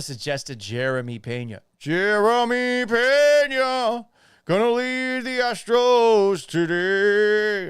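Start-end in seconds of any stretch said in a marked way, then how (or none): 1.8-7.7 high, loud, drawn-out mock announcement; mock hype shout. Host mocking the idea of Pena leading the Astros, mock hype shout. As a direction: belt it out, stretching every word.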